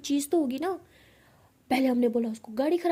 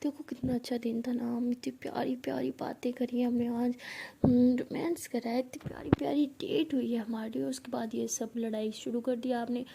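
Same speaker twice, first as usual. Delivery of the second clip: softer, breathy near-whisper; tender comforting; 5 dB quieter than usual. Acted. Pihu wipes her girlfriend's tears, tender comforting. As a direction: gently hush and coax her. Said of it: "उसे हग कर देती".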